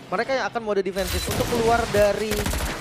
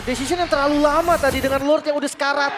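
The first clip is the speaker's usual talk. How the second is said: excited commentary; 6 semitones higher, 5 dB louder than usual. Real, not acted. Caster hypes turnaround.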